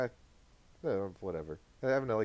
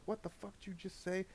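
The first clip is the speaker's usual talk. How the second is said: angry mom; 8 semitones higher, 10 dB quieter than usual. Retold or imitated. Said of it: "she's like".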